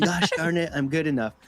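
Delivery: a hint of Stuart Smalley's sugary pep talk; mock cheesy affirmation voice; Stuart Smalley impression. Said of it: "sounds so Stuart Smalley, like I'm Al Franken on Saturday Night Live going".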